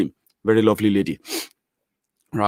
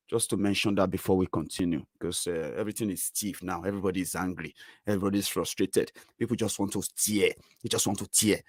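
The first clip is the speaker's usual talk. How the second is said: much softer, lowered voice; quiet aside; 3 semitones lower, 11 dB quieter than usual. Real, not acted. Telling viewers everyone is angry, soft and sober. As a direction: drop it down, serious.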